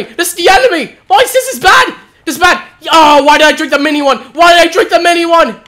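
rising panic, loud and high; frantic, voice climbing; panicking. Real, not acted. Losing to boss, panicking.

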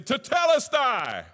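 triumphant war cry; victory battle cry. Retold or imitated. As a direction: bellow it like a winner over his beaten enemy. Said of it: "the victor when he would say".

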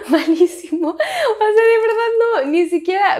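voz aguda entre carcajadas; riéndose de sí misma. Real, not acted. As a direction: habla muerta de risa, con el tono disparado. Se ríe de su mala imitación. (riéndose de su propia imitación)

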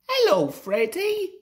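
very high-pitched, piping hello; whistling greeting. A narrator's reading. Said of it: "whistled Sir Handel".

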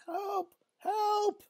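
small, very high falsetto call; cry for help. Acted. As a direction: squeak for help like a kid in trouble. Distant cries for help, acted.

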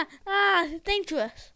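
normal talking voice

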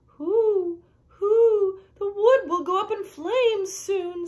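long high hoots, then slow warning; high, stretched storybook owl; hooting owl. A narrator's reading. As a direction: owl wailing doom, drawn out.

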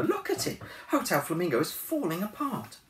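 put-on very high, exasperated girl; Anna's voice. A narrator's reading. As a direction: point at the mess, exasperated. Anna complains.

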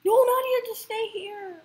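high, loud desperate shout; panicked yell. Real, not acted. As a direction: cry out her name in panic. Begs teammate to stay.

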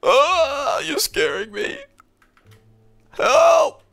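high hammed-up panic; mock fear. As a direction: cry for help playfully.

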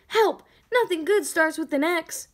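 frightened yelp; cry for help. Acted. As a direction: shout for rescue. Letter calling for help.

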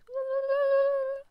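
very loud, high "woo"; long shrieked whoop. Real, not acted. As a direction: let out a piercing, drawn-out cheer. Celebrating before building first house.

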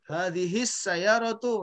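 slow "Hadihis sayyaratu", running straight into a held s; "Hadihis sayyaratu" read slowly, s doubled; lam left silent. Shown as a demonstration. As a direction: model "Hadihis sayyaratu" with no l sound. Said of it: "Lamnya jangan dibaca".

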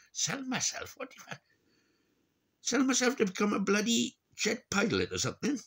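high scornful rising question; ranting at the Tory advice, high and scornful; sarcastic disbelief. Real, not acted. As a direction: pitch it up, scoffing at the idea. Sarcastic rant about selling himself.

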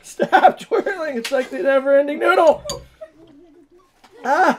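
loud, high, laughing panic; frantic laughing. Real, not acted. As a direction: laugh and urge loudly.

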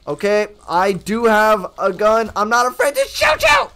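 building to a high yell; loud threat. Real, not acted. Player threatening an enemy with a gun, rising panicked yell. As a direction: warn it loudly, climbing to a shriek.

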